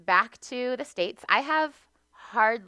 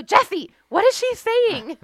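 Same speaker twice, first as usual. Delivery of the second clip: very high, loud, slow mock yell; comic, stretched-out desperate shriek; exasperated mom shout; 14 semitones higher, 10 dB louder than usual. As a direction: imitate a baffled mom calling out for help. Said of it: "And she'd go".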